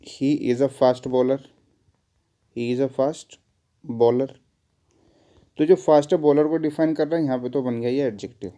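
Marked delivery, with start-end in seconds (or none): 0.0-4.3 very slow, spaced example sentence; slow dictation. Shown as a demonstration. Teacher giving an example sentence, very slowly. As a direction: lay each word out for the class. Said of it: "जैसे मैंने कहा".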